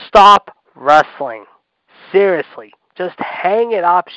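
loud, slow, spaced-out command; emphatic demand. Real, not acted. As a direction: punch each word loudly, exasperated. Angry demand to quit wrestling.

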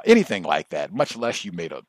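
ordinary talk

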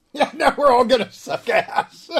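loud, high laughing words. Real, not acted. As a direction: laugh through the whole line. Friends laughing at bad rolls.

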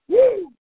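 a sudden high, loud whoop. Acted. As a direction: let out a wild whoop. Host whoops mid-rant.

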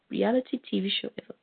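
ordinary talk, nothing marked

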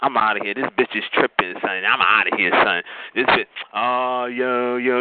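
low-pitched macho dude voice; straight man impression. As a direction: drop it low like a guy bailing out. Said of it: "this is what the straight men do".

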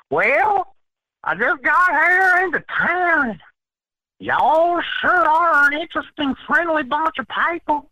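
high, loud, drawn-out Southern drawl; Southern boy. Retold or imitated. Southern boy greeting strangers in a New York bar, hammed-up drawl. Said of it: "asks the Southern boy".

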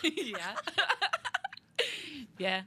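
laughing through a yes. Real, not acted. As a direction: break into high laughter. Laughing at the cornfield joke.

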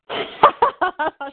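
high, rapid peal of laughter. Real, not acted. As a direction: laugh it off in a real, high burst. Laughs at daughter's blunt verdict.

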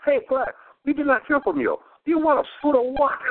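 pushy friendly phone check-in; imitating restaurant owner. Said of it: "I just love".

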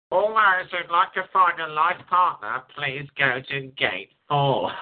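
airport tannoy announcement, half joking; announcer voice. Pretend. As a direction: call it out like a gate announcement. Mock airport gate announcement.

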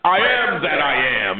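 put-on high, grandiose drunk preaching; drunk and arrogant. Drunk man to arresting cops, high grandiose mock-spiritual. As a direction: lord it over the cops like a prophet. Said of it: "now I'm drunk and arrogant and spiritual".

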